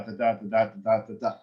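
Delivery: da da da beats in a steady iambic lilt; sing-song metre demo; iambic da da rhythm. Shown as a demonstration. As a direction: tap out the Shakespeare metre. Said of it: "that's Shakespeare".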